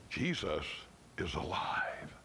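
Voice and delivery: low hushed whisper. Acted. Preacher whispering the truth to his church, hushed and awed.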